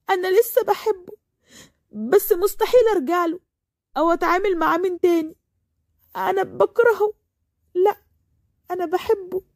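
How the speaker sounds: high-pitched, upset confession of love. Acted. Heartbroken confession to her sister.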